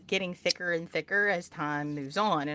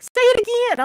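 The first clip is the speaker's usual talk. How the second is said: loud, high mock eagerness; delighted demand; 11 dB louder than usual. Amused Westerners begging for the phrase, loud high excitement. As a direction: egg the speaker on, pitched way up. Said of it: "And they're like".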